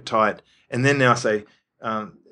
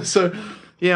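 ordinary delivery

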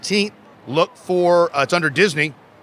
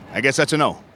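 usual speaking voice

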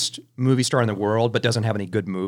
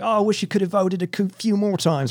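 higher, quick mock British accent; Jason Statham in British accent; 8 semitones higher than usual. Actor answering a fan who voted for him, British accent. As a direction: put on a playful, higher-pitched British accent. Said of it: "I'll just do a British accent".

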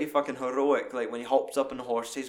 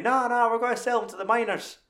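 much higher put-on voice for the henchman; mock Aussie henchman; 10 semitones higher, 3 dB louder than usual. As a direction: squeak it out like a hammed-up miner boss. Voicing henchman from the film.

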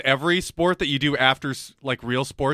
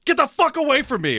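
shouting; 11 semitones higher than usual. Retold or imitated. Yelling at people near him.

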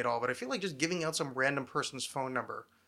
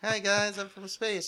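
higher, laughing alien greeting; 5 semitones higher, slower than usual. Pretend. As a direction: giggle through a friendly alien hello. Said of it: "kind of alien voice".

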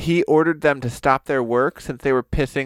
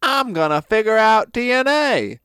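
higher, hammed-up American boast; brash American; 6 semitones higher, 3 dB louder than usual. American rival announces a plan, in a hammed-up brash voice. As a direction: proclaim it like a cocky rival.

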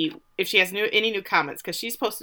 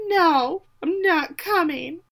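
teary; 10 semitones higher, slower than usual. Retold or imitated. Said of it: "teary-eyed and says".

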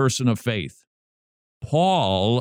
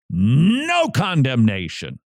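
«No» stressed, the phrase drawn out slowly; slow emphatic NO; 2 semitones higher, slower than usual. Shown as a demonstration. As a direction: land «No condemnation» slowly, with weight on «No». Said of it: "Paul puts "no" in the most persuasive form".

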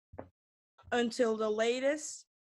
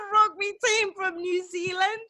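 laughing; 9 semitones higher, 7 dB louder than usual. Real, not acted. Reading banner, bursting into laughter.

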